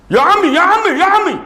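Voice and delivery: high, loud call of ya Ami. Acted.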